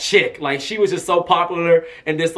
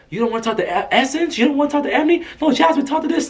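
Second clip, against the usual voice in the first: higher-pitched imitation of a pushy mom; 9 semitones higher, faster than usual. Mom urging singer toward press outlets, lightly imitated pushy mom. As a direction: imitate a mom nudging, voice lifted. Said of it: "Her mom was constantly saying".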